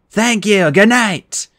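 high, loud, slow call; yelled. Acted. Percy yells at the filming Cupids, loud and defiant. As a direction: shout it at the cameras. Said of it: "I yelled".

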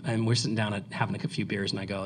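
ordinary talk, nothing marked

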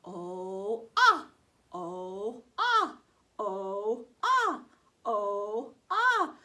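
teacher drilling O sounds; long and short O. Shown as a demonstration. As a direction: alternate "oh" and a loud "ah". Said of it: "vowel drill".

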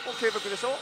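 ordinary talk, nothing marked

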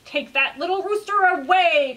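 king stung by bees, crying out; king cries out. Acted. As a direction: yelp as the bees sting. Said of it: "cried the king".

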